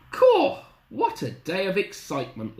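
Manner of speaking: high, loud exclamation settling down; merry Granny. Acted. Granny after the rescue, high merry exclamation. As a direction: exclaim as a chuffed old Granny. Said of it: "laughed Granny".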